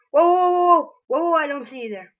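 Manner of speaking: loud startled whoa; startled shout. Real, not acted. Player startled by a hidden enemy, shouting whoa. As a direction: yelp in surprise at a sudden enemy.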